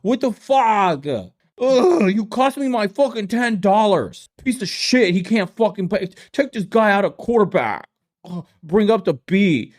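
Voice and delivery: high, hammed-up fan rant; mock angry fan. Mocking fans ranting at their team, high voice. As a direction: rant at the quarterback like a sore fan.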